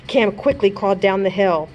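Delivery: ordinary delivery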